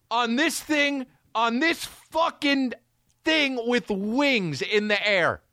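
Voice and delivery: high, strained yell; high shouted mock panic; panicked rant. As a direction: squeal it like a frantic passenger.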